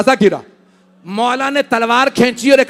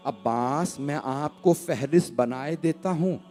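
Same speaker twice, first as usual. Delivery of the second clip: hushed, much softer grieving narration; soft mournful recitation; 5 semitones lower, 6 dB quieter than usual. Acted. Preacher reciting the farewell at the Prophet's grave, hushed and mournful. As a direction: draw the mourners in close with quiet grief.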